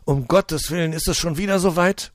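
high, loud alarmed outcry; panicked cry. Acted. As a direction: cry out in dismay. Old folks crying out in panic. (panisch aufschreiend)

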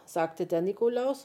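usual speaking voice

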